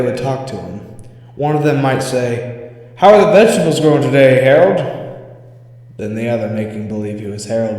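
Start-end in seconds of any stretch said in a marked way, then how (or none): 3.0-4.8 playful teasing. Acted. Playful talk to the doll.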